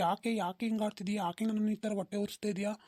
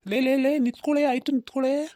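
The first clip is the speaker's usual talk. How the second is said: higher, louder calls after her; calling out; 4 semitones higher, 7 dB louder than usual. Acted. The boyfriend shouts after his girlfriend as she leaves. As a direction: shout to stop her walking away.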